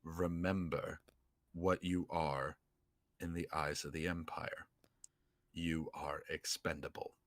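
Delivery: hushed, deliberate villain impression; attempted Vader. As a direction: try to be Vader without quite the gravitas. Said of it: "a try to be Vader".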